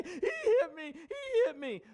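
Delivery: high-pitched fake blubbering; hammed-up childish wailing; mock crying child. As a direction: imitate a tearful kid pleading his case. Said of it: "look at their crying child and say".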